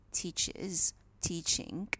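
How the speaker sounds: ordinary talk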